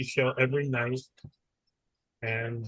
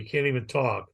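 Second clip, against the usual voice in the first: ordinary talk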